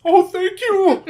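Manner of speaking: very high, slow squeaky voice; high silly voice. Acted.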